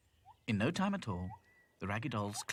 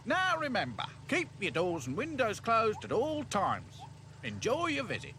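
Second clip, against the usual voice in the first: gate man; 13 semitones higher than usual. Acted. Gate man instructs visitors.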